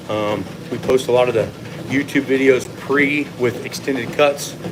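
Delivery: ordinary talk, nothing marked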